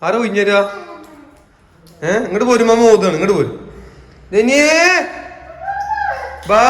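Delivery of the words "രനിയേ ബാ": calling out. Real, not acted. Parent calls a child to come, raised calling voice.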